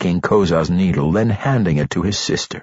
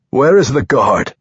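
loud, higher, alarmed demand; surprised alarm; 5 semitones higher, 5 dB louder than usual. A narrator's reading. Archmage finding the guard missing, alarmed. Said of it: "with surprise and alarm".

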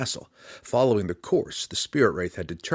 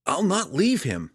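firm refusal, pitched much higher; 9 semitones higher than usual. A narrator's reading. Drizzt refusing to leave his father, firm and raised. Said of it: "he said firmly".

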